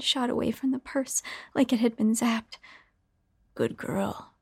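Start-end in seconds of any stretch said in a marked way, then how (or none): 3.5-4.3 sing-song man. A narrator's reading. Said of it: "he sang".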